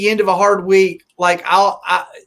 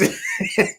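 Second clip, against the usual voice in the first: high laugh burst; 5 dB quieter than usual. Real, not acted.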